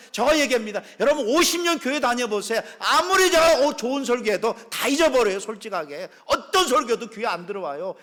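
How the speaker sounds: 목소리를 높여 열정적으로 외치는 설교; 회중을 향해 힘껏 부르짖듯 설교; full-force preaching. Acted. (높고 크게 외치는 설교조)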